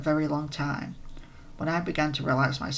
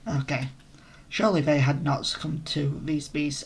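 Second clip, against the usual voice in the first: normal talking voice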